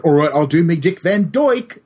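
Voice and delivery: exaggerated fake British Cockney; mock Cockney. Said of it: "It must have been Mockney".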